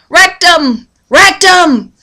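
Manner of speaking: put-on high, loud childlike yell of Rectum; mock little boy hollering down the aisle; imitating a kid hollering. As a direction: kid shouting through the grocery store. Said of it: "he was hollering really, really loud".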